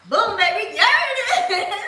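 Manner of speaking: loud, high gloating cheer; loud victory shout at the camera; triumphant cheer. Real, not acted. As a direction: crow it like you just won. Celebrating a compliment from chat.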